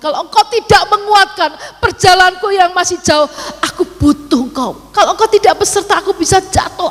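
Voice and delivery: urgent high, loud plea to God; pleading prayer. Retold or imitated. Retelling her pleading prayer to God. (permohonan doa yang mendesak)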